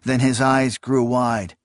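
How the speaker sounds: ordinary delivery, nothing marked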